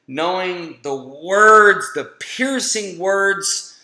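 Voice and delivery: slow, leaning hard on the piercing words; emphatic. Real, not acted. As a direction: drag each word out for weight. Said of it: "emphatic slow build".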